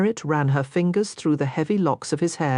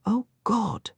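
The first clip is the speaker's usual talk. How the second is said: higher, desperate little cry; breathy gasp; 5 semitones higher than usual. Acted. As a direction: gasp it out in pleasure. Heroine's aroused cry.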